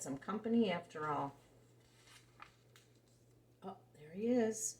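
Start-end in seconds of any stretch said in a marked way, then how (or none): none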